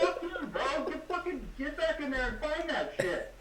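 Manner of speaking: high, laughing fake fury at the counter guy; laughing mock rant, slow at the end; laughing mock outrage. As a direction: yell at him while cracking up. Mock yelling at Popeyes worker.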